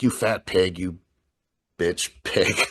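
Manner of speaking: usual speaking voice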